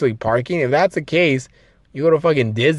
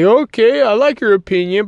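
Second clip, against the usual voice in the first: polite acknowledgement; 8 semitones higher, 4 dB louder than usual. Retold or imitated. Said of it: "and be like".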